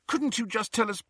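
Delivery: put-on high-pitched, imploring girl's voice; anxious, coaxing plea; pleading. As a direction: beg earnestly, pitch held high. Girl pleads with departing portrait.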